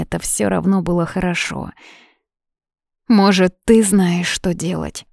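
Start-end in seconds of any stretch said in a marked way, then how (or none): none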